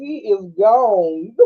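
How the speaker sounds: slow, drawn out through laughter; real laughter; laughing drawl. Real, not acted. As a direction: stretch it out laughing. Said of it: "I was cracking up".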